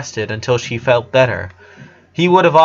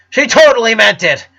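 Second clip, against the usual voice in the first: raised voice, louder and higher; hurt yell at her brother; louder hurt outburst; 8 semitones higher, 5 dB louder than usual. A narrator's reading. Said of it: "Luna yelled".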